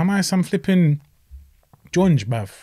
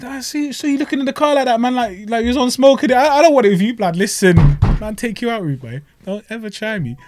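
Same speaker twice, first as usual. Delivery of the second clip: higher playful street threat; mock tough talk; 6 semitones higher than usual. Teasing a boxer at the traffic lights, mock tough talk. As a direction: tease him with fake bravado. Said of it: "I said".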